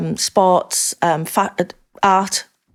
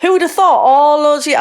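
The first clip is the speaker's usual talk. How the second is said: excited; 9 semitones higher, 7 dB louder than usual. Real, not acted. Elated about Vogue photo.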